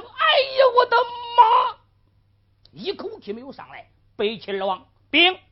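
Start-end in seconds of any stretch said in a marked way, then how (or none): 0.0-1.8 高声哭喊“哎呀，我的妈”; 受刑痛极，放声惨叫. Acted. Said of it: "徐文彪大叫一声". (徐文彪受刑后对天惨呼，嘶声哭喊)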